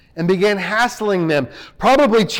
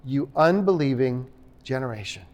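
low, soft, settled verdict; hushed closing statement; quiet conclusion; 8 semitones lower, 8 dB quieter than usual. Shown as a demonstration. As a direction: let it land quietly as a final judgment. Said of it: "He is concluding".

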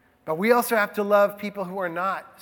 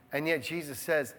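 usual speaking voice

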